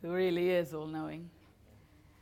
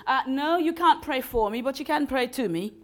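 a lightly voiced, louder, higher deluded woman; mock-grand reply; 6 semitones higher, 6 dB louder than usual. Retelling the woman who thought she was God, mock-grand. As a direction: hand down the answer like a deity, half-laughing.

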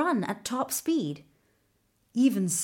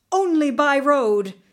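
crying out; 5 semitones higher, 7 dB louder than usual. A narrator's reading. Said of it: "he cried and ran".

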